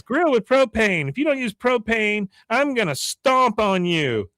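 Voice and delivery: high mock proclamation; imitated high-voiced giant's warning; booming threat. Giant figure threatens the crowd.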